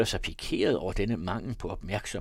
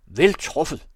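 high, loud exclamation; admiring shout; 6 semitones higher, 10 dB louder than usual. A narrator's reading. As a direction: cry out in sudden approval. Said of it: "råbte han".

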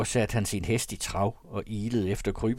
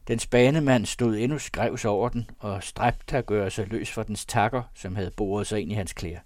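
usual speaking voice

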